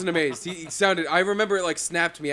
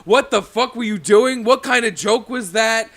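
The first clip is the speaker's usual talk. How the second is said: angry crowd; 5 semitones higher, 7 dB louder, slower than usual. Retold or imitated. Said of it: "all with angry faces, just like".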